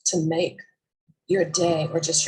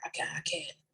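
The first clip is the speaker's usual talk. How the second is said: whisper; 11 dB quieter than usual. Retold or imitated. Retold scared officer pleading.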